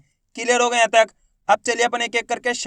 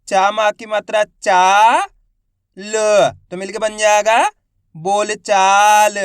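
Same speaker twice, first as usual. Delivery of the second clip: each syllable stretched out and said louder; slow syllable drill; 5 dB louder, much slower than usual. Shown as a demonstration. Teacher spells out a word for pupils, drawn out. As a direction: sound out every syllable slowly for pupils. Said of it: "तो अपन बा का उच्चारण कैसे करेंगे".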